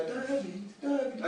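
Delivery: soft hushed call of the name; very quiet calling. Retold or imitated. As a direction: call him as quietly as you can. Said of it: "tak cichutko, najciszej jak potrafiła".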